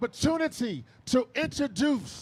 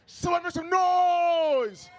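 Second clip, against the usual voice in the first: slow, drawn-out hype shout; crowd hype shout; 5 semitones higher, much slower than usual. Real, not acted. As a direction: pump up the crowd. Host hyping the crowd.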